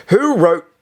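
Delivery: very high, loud accusing start; teacher demanding who wrote the word; loud sharp demand. A narrator's reading. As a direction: snap the question then cut it off. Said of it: "she started to say".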